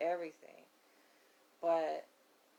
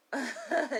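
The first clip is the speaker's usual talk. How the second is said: a short real laugh, louder; 9 semitones higher, 8 dB louder than usual. Real, not acted. Laughing about going alone.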